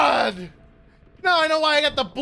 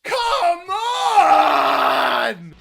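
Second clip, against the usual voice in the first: high wail with long stretched final words; howling disbelief; 3 semitones higher, much slower than usual. Real, not acted. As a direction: howl it out in real frustration. Enemy would not die.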